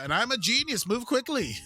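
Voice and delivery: high, loud boast; mock bravado. Host closing the show with a high loud boast. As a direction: puff up like a self-declared genius.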